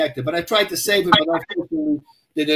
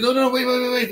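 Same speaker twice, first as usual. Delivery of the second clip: higher, rapidly repeated mock plea; urgent pleading; 6 semitones higher than usual. Storyteller to the freaked-out dog owner, imitated urgent pleading. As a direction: retell it as hurried reassurance. Urging owner to hold on, retold.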